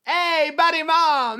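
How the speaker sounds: mock Canadian accent, calling to mom. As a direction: chirp it out like a cheery Canadian. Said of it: "They're Canadian".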